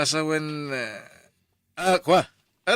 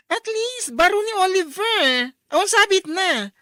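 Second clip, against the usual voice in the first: excited gossip; 10 semitones higher, 6 dB louder than usual. Acted. Office mate gossiping, high excited.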